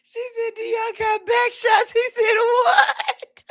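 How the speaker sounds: high-pitched retelling through real laughter; laughing to tears. Real, not acted. Host retelling the back shots prank, laughing to tears. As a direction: squeal the quotes out, helpless with laughter. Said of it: "I'm over here in tears".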